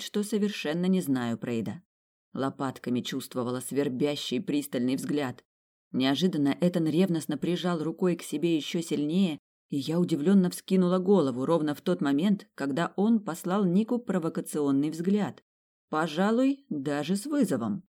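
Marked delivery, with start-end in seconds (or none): none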